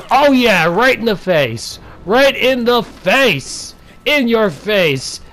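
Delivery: high, slow taunting crow; gloating. Acted. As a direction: rub it in, stretching every word. Gloating after a kill.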